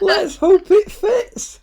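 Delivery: very high and slow; high drawn-out echo. Pretend. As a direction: stretch it out way up high. Host echoes guest, drawn out high.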